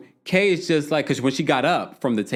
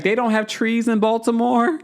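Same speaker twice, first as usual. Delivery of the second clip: mock incredulous question; 10 semitones higher, 3 dB louder than usual. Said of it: "She's like".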